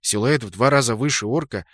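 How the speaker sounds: ordinary delivery, nothing marked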